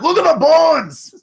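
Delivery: put-on high, loud Scottish accent shout. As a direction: belt it out in a Scottish brogue. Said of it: "Every time".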